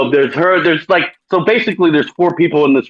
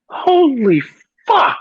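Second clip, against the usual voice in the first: rising, pitched-up disbelieving cry; shocked exclamation; 11 semitones higher, much slower than usual. Retold or imitated. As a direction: let it climb way up in shock. Shocked by the exam detail.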